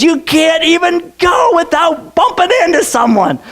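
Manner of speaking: hammed-up panic about them everywhere; a fearmonger's mock cry; mock alarmed shout.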